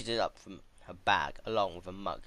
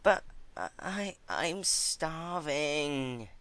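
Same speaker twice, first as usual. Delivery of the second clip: slow, stammering, higher whine; whining; 8 semitones higher, much slower than usual. Acted. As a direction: drag out the plea. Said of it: "she whined loudly".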